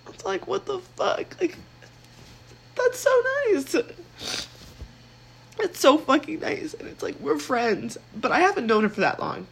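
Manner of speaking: tearful squeak, touched and overwhelmed; crying. Real, not acted. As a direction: voice cracking high while crying. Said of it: "I'm not crying over a boy right now".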